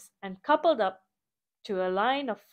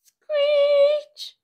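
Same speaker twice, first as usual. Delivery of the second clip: high loud sung screech; screeching wheels; 9 dB louder than usual. Acted. Wheels screeching as the little train slips. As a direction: screech like metal wheels slipping.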